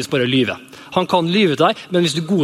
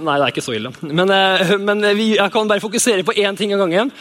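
usual speaking voice

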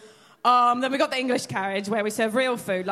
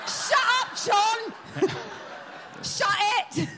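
mock shout with laughter, high pitched; playful mock yell of Shut up, John; 10 semitones higher, slower than usual.

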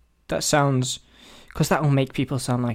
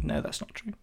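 whispered aside; 7 semitones higher, 10 dB quieter than usual. Real, not acted.

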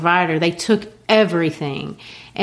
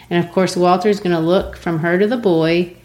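ordinary talk, nothing marked

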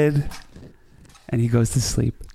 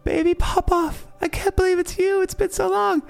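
sister's joyful cry. Acted. Sister greeting her lost baby brother, very high joyful voice. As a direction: squeal it up high, reunion joy. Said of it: "she goes".

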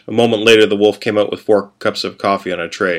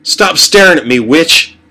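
loud, higher furious snarl; growled; 7 semitones higher, 6 dB louder, slower than usual. Acted. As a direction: snap it at the witch across the table. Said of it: "Casey growled".

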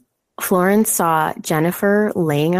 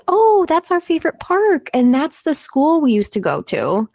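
put-on little girl voice; 9 semitones higher than usual. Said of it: "the little girls were like".

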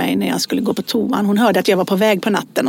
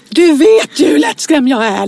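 louder, higher burst of telling-off; retold angry scolding; 5 semitones higher, 5 dB louder than usual. Mother to daughter who scared her, scolding. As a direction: snap at her, reenacting the outburst. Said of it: "jag skällde ju ut".

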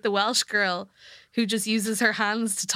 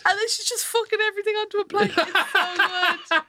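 excited; 12 semitones higher, 3 dB louder than usual. Real, not acted. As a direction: gush with delight. Excited retelling of cooking show.

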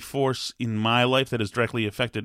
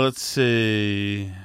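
usual speaking voice

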